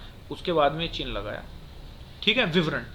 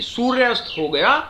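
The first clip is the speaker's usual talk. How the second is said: loud, higher सूर्यास्त हो गया, rising at गया; semicolon pause; 5 semitones higher, 8 dB louder than usual. Shown as a demonstration. Teacher re-shows where to stop, lifting गया in सूर्यास्त हो गया. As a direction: lift गया and hold before going on. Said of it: "उसके बाद हम रुके".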